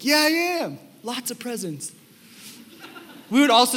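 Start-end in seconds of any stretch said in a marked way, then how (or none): 0.0-1.9 put-on smug kid. Boy bragging to friends.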